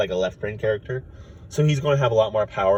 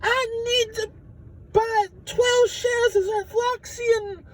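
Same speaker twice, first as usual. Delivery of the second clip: very high, slow, squeaky alien voice; squeaky alien trader; much slower than usual. Retold or imitated. Alien trader shoving past, squeaky high voice. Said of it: "some guy pushes him away and says".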